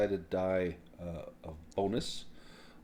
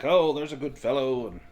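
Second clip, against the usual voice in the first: louder, higher, hearty thanks; hearty grateful humans; 5 semitones higher, 7 dB louder than usual. Acted. Human travelers loudly thanking the dwarf, hearty. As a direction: clap him on the back and boom your thanks.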